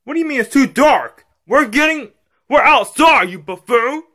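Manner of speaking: put-on high-pitched exasperated child; indignant kid. Kid disputing dad about the dark, indignant. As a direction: argue high and bratty.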